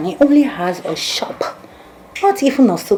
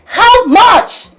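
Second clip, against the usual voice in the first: high, loud, exasperated outburst; furious shouting; 7 semitones higher, 8 dB louder than usual. Acted. Woman promising her man a refund, shouting in exasperation. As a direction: yell back, pitch way up.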